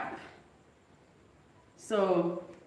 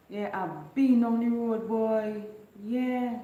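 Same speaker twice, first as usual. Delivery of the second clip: Caribbean dialect, "I've been" said "ab in", excited and high; island dialect gossip; 4 semitones higher, slower than usual. Retold or imitated. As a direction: play a chatty neighbour in thick island dialect. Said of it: "another thing you hear say".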